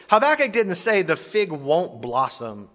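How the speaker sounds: ordinary delivery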